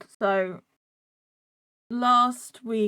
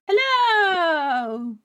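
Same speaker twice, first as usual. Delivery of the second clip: calling hello; 7 semitones higher, 5 dB louder than usual. Real, not acted. Greeting on stream start.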